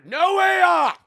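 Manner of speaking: high, very loud yell; emphatic shout. Real, not acted. Streamer quoting instructions given to the designer, emphatic shout. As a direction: shout it as a proud boast. Repeats boast about designer.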